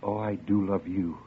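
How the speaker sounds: low, soft murmur of love; tender confession. Acted. Dreamer confessing love to Isabel, tender low murmur. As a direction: breathe it out gently, pitched low.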